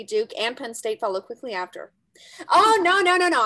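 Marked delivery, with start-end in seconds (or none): 2.5-3.4 loud, high string of nos; dramatic no. Acted. Girl cutting off her friend's pep talk, loud and high. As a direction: cut her off before she speaks.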